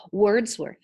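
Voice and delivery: plain reading voice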